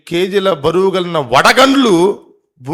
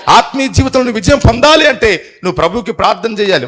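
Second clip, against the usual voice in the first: fervent preaching; 5 semitones higher, 6 dB louder than usual. Acted. Preacher urges prayer.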